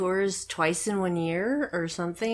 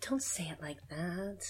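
whispering; 14 dB quieter than usual. Real, not acted. Whispers throughout.

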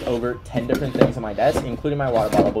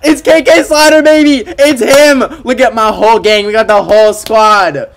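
much higher, much louder teary cheering; ecstatic shout; 8 semitones higher, 11 dB louder than usual. Real, not acted. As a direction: scream with joy at your idol. Idol appears on screen.

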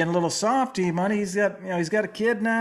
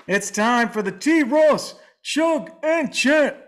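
announcer; 5 semitones higher, slower than usual. Acted. Segment announcement.

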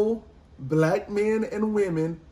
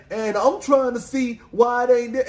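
ordinary talk

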